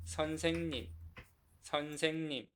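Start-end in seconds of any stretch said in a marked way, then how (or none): none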